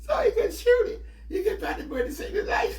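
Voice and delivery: high, loud words through laughter; can barely talk for laughing; laughing hard. Real, not acted. Laughing hard at cousin's story.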